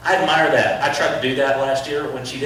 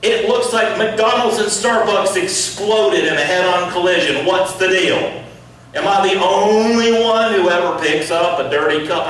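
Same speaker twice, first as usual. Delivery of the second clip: put-on loud, higher fed-up rant at the kids; exasperated dad; 6 semitones higher, 5 dB louder than usual. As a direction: complain loudly about the messy car. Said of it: "I say to my kids".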